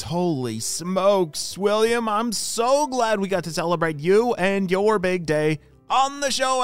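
ordinary delivery

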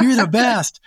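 a hint of a grateful puppy gushing; dog's adoring voice. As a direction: owner lightly voicing his dog's adoration. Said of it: "So your dog is going".

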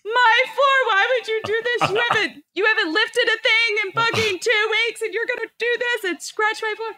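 very high, wailing complaint; mock crying. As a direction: act out a tearful meltdown at a husband. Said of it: "I fell on the ground crying".

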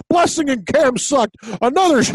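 high-pitched mock balcony heckler; Waldorf and Statler. Co-host imagining balcony critics ripping the show, mock Muppet heckling. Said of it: "like Waldorf and Stadler from the Muppets".

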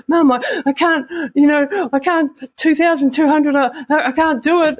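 high, tearful retold plea to mum; imitating a tearful breakdown, voice pitched high; panicked crying. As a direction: sob it out like a panicking child. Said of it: "I'm bawling and I can't breathe".

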